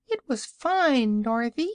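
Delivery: piglet calling out. A narrator's reading. Piglet calls to Dorothy about the fruit, high squeaky voice. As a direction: call it out in a tiny piggy voice. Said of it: "called one of the piglets".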